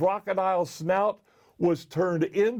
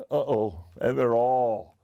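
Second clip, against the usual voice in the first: plain reading voice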